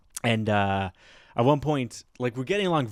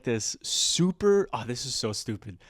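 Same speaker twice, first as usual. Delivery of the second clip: ordinary delivery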